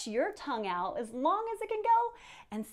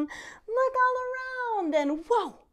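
much higher, slow, swelling to whoa; excited build; 12 semitones higher, 4 dB louder than usual. Acted. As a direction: stretch it out in wonder. Binocular game repeated.

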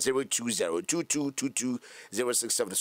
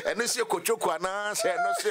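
laughing; 8 semitones higher than usual. Real, not acted. Host laughing through a lyric.

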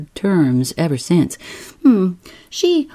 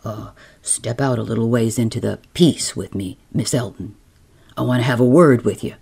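a lower, gruff-free skipper voice; low man's voice; 7 semitones lower, faster than usual. Acted. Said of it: "says he".

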